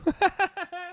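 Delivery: high staccato laugh; short burst of high-pitched giggling; high giggle. Real, not acted. Laughs after dismissing Charlie Day.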